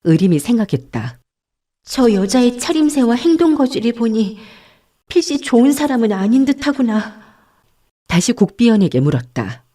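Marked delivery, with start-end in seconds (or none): 1.8-7.2 lightly voiced young woman, higher; young nun's thought. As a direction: think it to yourself, pitched up. Character's inner voice. (높은 톤의 여승 속마음)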